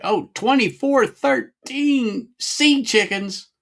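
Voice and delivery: high, slow goofy voice; high playful silly exclamation. Real, not acted. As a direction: put on a silly cartoon voice. Goofy exclamation after sip.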